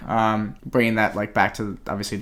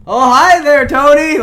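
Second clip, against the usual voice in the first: imitating a booming hello from the door; loud greeting; 12 dB louder than usual. Mister Riley greeting Tony from the door, loud. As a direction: call it out high and loud across a room. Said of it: "Mister Riley talking really loudly".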